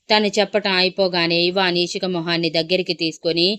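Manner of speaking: plain reading voice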